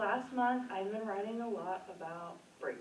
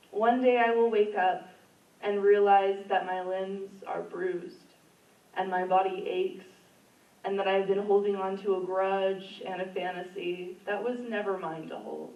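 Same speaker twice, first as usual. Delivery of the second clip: poem reading; 5 dB louder than usual. Acted. Poet reads her breakup poem to the open mic crowd, impassioned. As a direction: perform the poem, letting it swell.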